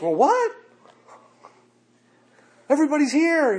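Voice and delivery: high, loud astonished outburst; stunned jailer. Acted. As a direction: blurt it in disbelief. Jailer stunned by open prison.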